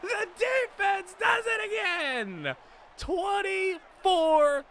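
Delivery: shouting the big defensive score, elated; excited shouting. Real, not acted. Player celebrating his team's touchdown: elated shouting. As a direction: yell it out like the game's won.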